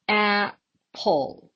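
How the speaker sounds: "Apple" split in two, AP, PLE; syllable by syllable. Shown as a demonstration. Teacher counting syllables for learners, word broken in two. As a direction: pause between the syllables of Apple. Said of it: "How many syllables are there?".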